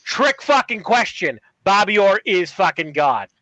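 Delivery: put-on slow, emphatic Boston accent; hammed-up Boston accent. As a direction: pose the riddle like a Boston sports fan. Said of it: "I'm reading this in a Boston ac".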